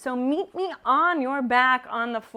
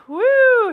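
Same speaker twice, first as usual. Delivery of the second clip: high, loud "woo" of exertion; effort whoop; 11 semitones higher, 11 dB louder than usual. Real, not acted. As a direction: let out a loud whoop through the burn. Exertion in a core workout.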